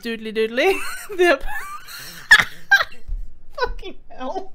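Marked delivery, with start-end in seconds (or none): 0.0-0.8 Ned Flanders voice. Acted. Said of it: "He looks like Flanders, so I'm trying to give him a voice".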